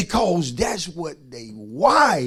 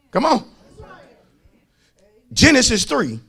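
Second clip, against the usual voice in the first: usual speaking voice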